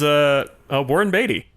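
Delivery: usual speaking voice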